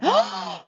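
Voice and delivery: sharp, high horrified gasp; shocked gasp. Retold or imitated. Shocked at marijuana news.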